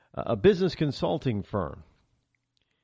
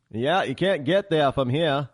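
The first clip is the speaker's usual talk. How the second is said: slowed-down, louder Maine accent; 3 dB louder than usual. Shown as a demonstration. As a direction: do a Maine drawl with a slowing cadence. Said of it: "if you go to Maine, cadence slows down".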